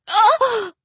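high, loud woman's scream; scream of pain. A narrator's reading. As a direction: cry out sharply, cut off mid-sentence. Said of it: "Her inquiry ended in another scream".

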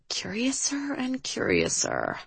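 slow, very soft, breath-only aside; whispered mumble. A narrator's reading. Driver remarks to herself on Liv's odd request, whispered mumble. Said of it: "Shannon mumbled".

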